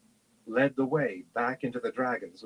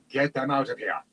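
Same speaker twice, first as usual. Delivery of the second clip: urgent order, only slightly raised; restrained shout. A narrator's reading. Man facing a dragon, shouting mildly to clear children. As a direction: call out a command, held back.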